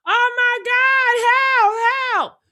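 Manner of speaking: high, slow, drawn-out pleas of panic; panicked cry for help. Pretend. Imagining herself sinking, crying out in panic. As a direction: wail for help as the boat goes under. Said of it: "Somebody should be screaming".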